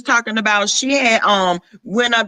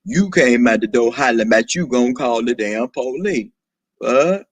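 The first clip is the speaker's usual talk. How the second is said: much lower, deep register throughout; 10 semitones lower than usual. Acted. Questioning why she would call the police, deep voice. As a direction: argue it out from way down low. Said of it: "What in the deep voice".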